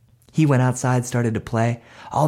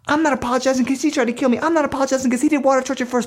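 fast, high-pitched protest; squabbling kids; 12 semitones higher, faster than usual. Acted. Two brothers refuse mom's order, fast high whining protest. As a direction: fire off stubborn refusals in a high kid voice.

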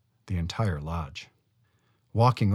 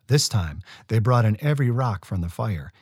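plain reading voice

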